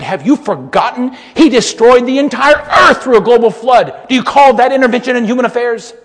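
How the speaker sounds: loud, high fiery preaching; preaching at full force. Acted. Preacher thundering at the congregation about the flood, fiery. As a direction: drive it home from the pulpit.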